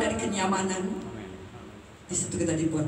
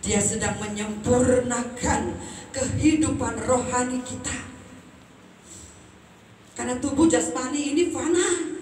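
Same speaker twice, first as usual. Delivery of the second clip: full-force preaching; 9 semitones higher, 5 dB louder than usual. Acted. Preacher exhorting congregation on the soul, loud ringing preaching. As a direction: drive home the growth of the spirit.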